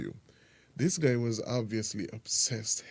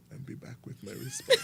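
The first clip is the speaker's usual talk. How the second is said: laughing; 3 dB quieter than usual. Real, not acted. Letter reader jokes about rum.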